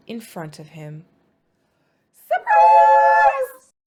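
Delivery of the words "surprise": much higher, much louder cheer of surprise; surprise shout. Acted. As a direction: burst out like a crowd at a party. Surprise party reveal.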